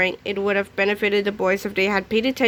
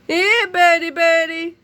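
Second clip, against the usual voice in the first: calling a pet; 7 semitones higher, 4 dB louder, slower than usual. Acted.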